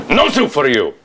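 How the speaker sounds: put-on high, barked catchphrase with an accent; Soup Nazi. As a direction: dismiss the customer with a sharp, high order. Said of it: "I guess I'm playing the Soup Nazi".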